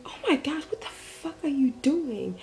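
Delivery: retold scream. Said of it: "I was screaming".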